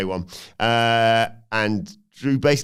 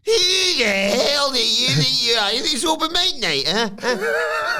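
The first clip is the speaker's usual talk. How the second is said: much higher, slow sneering taunt; high mocking Fatu taunt; 3 dB louder, slower than usual. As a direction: taunt him in a silly high voice.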